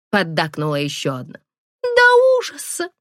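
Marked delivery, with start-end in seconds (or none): 1.8-2.9 put-on very high, slow girlish coo; coy girlish voice.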